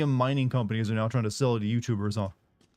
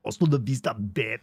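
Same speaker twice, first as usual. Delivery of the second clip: wrong Arnold tone; 2 semitones lower than usual. Retold or imitated. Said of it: "doesn't use that tone of voice".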